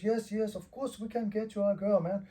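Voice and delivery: a hint of an obliging host; imitated reassuring fixer. Imitating a fixer reassuring eager fighter.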